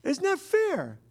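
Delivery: high, startled cry of unfairness; a squeaky, hammed-up "what?" of protest; indignant disbelief. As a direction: play up your own outrage at the unfairness. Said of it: "And I look at myself and say".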